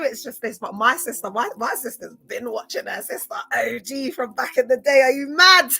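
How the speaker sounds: ordinary delivery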